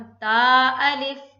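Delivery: ता drawn out for two beats, loud; tajweed recitation. Shown as a demonstration. Teacher recites for students, loud chant. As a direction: chant ता अलिफ, stretching ता. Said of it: "तो इसे पढ़ेंगे हम".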